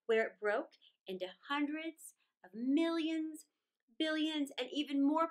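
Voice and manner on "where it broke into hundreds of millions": plain reading voice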